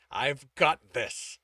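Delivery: slow, pressed through gritted teeth. A narrator's reading. Tibbs refusing to let go, through gritted teeth. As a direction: hold the anger in behind the teeth. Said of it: "he said through gritted teeth".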